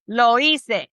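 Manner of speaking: Lo hice said as "lo ee-seh", the H silent. Shown as a demonstration. Teacher models Lo hice with the H silent for the class. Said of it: "Remember, the H is silent".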